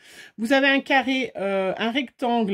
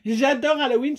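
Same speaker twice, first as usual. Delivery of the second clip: ordinary talk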